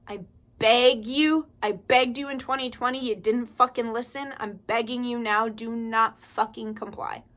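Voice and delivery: ordinary delivery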